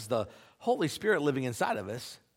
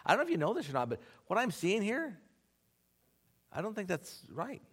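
quick, gentle and tactful, trailing off soft; easing into a hard truth; gentle confrontation; 5 semitones higher, 4 dB quieter than usual. Retold or imitated. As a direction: break it to a friend kindly. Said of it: "going to them and say".

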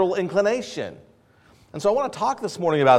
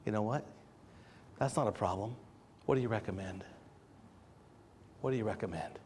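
much softer, calm and kind; gracious response; 10 semitones lower, 20 dB quieter than usual. Retold or imitated. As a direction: reassure the server gently.

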